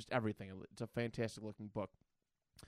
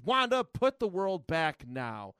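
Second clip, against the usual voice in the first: loud, slow mock-angry command, pitched up; mad; 5 semitones higher, 7 dB louder, slower than usual. Professor losing patience with Wanda, acted anger. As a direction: order her sternly, stretching the words out. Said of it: "he gets mad".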